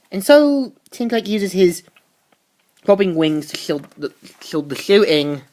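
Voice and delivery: usual speaking voice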